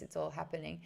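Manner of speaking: ordinary talk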